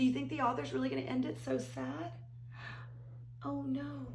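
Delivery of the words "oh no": ordinary talk, nothing marked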